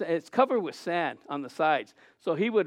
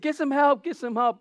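higher-pitched mock call for help; urgent plea; 7 semitones higher than usual. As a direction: imitate calling out for rescue. Retold plea during canal rescue.

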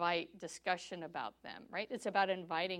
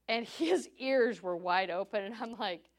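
normal talking voice

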